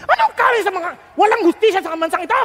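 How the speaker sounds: fast, loud, angry demand; furious king demanding justice, quick and loud. Retold or imitated. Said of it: "galit si David".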